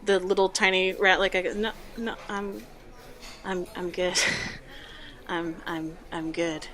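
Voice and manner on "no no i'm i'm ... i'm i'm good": soft, halting stammer; small, nervous voice trying to stay out of it. Acted. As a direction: back out timidly with hesitant repeats. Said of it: "he looks real, real nervous".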